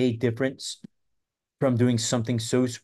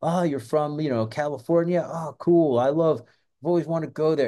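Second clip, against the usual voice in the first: higher-pitched, over-friendly hustler act; mock-friendly street hustler, pitched up; con man's patter; 5 semitones higher than usual. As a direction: play a chummy stranger fishing for details. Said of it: "the classic con man trick".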